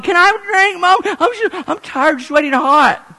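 put-on high, loud tired child; a kid begging mom for a drink; high childish whine; whiny kid. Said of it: "I want to drink".

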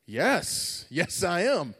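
hammed-up fake excitement; bluffed enthusiasm. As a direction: play along too emphatically. Bluffing that he knew of anniversary.

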